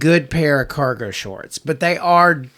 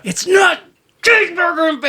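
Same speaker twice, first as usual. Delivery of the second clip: frantic shout; 13 semitones higher than usual. Retold or imitated. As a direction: yell like you've snapped. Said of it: "he just loses his mind".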